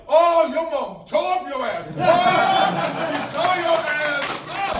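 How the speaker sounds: high, loud, slow jeering shout with laughter; kids mocking. Kids at the public pool taunt him, high laughing shout. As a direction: yell it like kids teasing at the pool.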